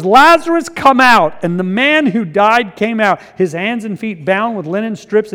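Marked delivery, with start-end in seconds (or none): 0.0-1.4 higher, louder commanding call; loud cry. A narrator's reading. Jesus calls Lazarus out of the tomb, loud cry. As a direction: call the dead man out in a loud voice. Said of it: "he cried out in a loud voice".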